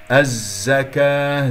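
الزكاة slow with a buzzing doubled zay; drawn-out recited الزكاة; tajweed demonstration. Shown as a demonstration. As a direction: hold the z of الزكاة, voice flowing. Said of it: "مشددة ويجري فيها الصوت".

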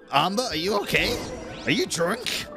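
ordinary talk, nothing marked

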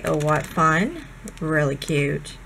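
normal talking voice

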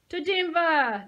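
loud, high overexcited cheer; mocking cheer. A narrator's reading. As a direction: cheer it as a joke. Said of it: "mocking and overexcited".

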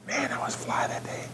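softly whispered brag; whispered aside. Real, not acted. Speaker brags to the audience about his suit, whispered. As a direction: lean in and whisper it to the crowd.

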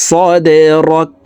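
slow chanted "সদে রক", stopping on a closed k; waqf stop. Shown as a demonstration. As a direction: draw out "সদে", then stop "রক" without a final vowel. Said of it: "এইভাবে থামবো। দেখুন". ("রক"-এ সাকিন করে থামা, টেনে তিলাওয়াত)

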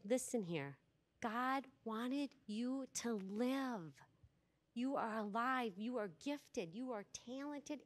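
soft, measured reassurance; tender affirmation. Retold or imitated. Retreat leader affirming a tearful boy, tender and earnest. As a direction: quietly build him up, phrase by phrase.

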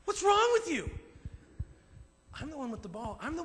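the dog sassing its owner; cheeky dog scolding the owner; indignant dog. Retold or imitated. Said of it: "looks at you like".

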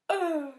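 waking gasp. Acted. Goat wakes with a gasp.

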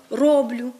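«роблю» stressed on the first syllable; діалектний наголос. Shown as a demonstration. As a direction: say «роблю» as ROblyu, dialect stress. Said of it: "вплив діалектного мовлення". («роблю» з наголосом на першому складі)

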